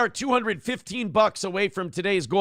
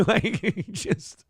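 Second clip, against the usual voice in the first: ordinary talk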